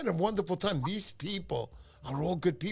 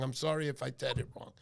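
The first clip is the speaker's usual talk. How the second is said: ordinary delivery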